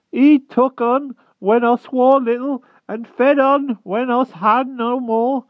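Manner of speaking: very high, slow, timid little creature; humble hedgehog voice. Acted. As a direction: squeak it out humbly like a shy hedgehog. Hedgehog keeps speaking.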